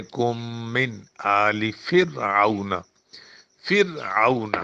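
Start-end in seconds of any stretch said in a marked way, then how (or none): 0.0-2.7 کم من said very slowly with a long nasal ghunna on the م. Shown as a demonstration. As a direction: hum the م of من through the nose and hold it. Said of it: "اس میں تھوڑا سا غنہ کریں".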